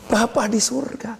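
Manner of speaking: ordinary talk, nothing marked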